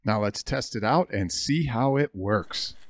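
normal talking voice

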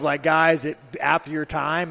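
ordinary talk, nothing marked

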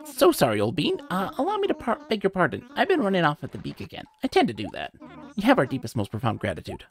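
a rambling bird catching himself; apologetic owl curator. Acted. Owl curator apologising to the donor, lightly high fussy voice.

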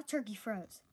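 ordinary delivery

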